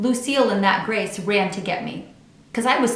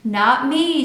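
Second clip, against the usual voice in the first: playful brag; 6 semitones higher, 7 dB louder than usual. A narrator's reading. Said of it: "Lucille twirled all around".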